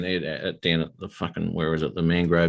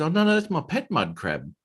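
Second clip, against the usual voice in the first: high-pitched mock-innocent protest; innocent crab catcher; 9 semitones higher than usual. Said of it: "And he goes".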